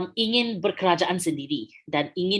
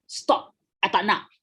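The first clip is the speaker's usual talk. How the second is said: blunt order; 4 dB louder, slower than usual. Retold or imitated. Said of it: "instead of telling".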